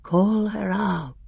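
high, slow, eerie summons; grey, terrible voice. A narrator's reading. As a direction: draw it out with cold menace. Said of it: "said the grey voice".

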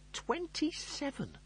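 high, slow outraged cry of twenty-seven; appalled shriek at the number; horrified disbelief. Acted. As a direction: squeal it like it's a death sentence. Lister's horrified inner echo.

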